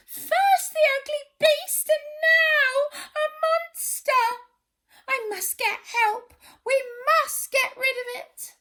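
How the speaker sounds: high, loud squeaky panic; high frightened pleading. Acted. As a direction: beg in a tiny panicked voice. Frightened bush baby pleads.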